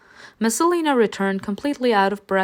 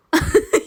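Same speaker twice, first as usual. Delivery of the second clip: high burst of laughter; 10 semitones higher, 4 dB louder than usual. Real, not acted. Laughs at the dragon.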